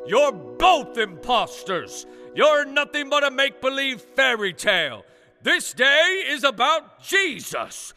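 yelling zealot. Acted. Man dressed as Jesus denouncing both Santas, yelling. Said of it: "crowd, yelling".

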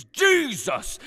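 high-pitched mock yell; yelled retort. Jesus actor arguing with fake Santa, mock yelling. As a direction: throw it back as a rival's cry. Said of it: "And he yells".

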